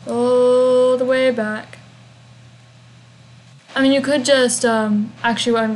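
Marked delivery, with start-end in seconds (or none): 0.1-1.8 very slow, stretched-out words on a level pitch; drawn-out. Real, not acted. Player narrating the long trek back to the quest giver, drawn-out. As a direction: draw out each word, especially a long "All".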